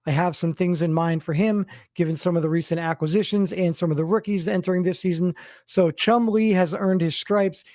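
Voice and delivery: normal talking voice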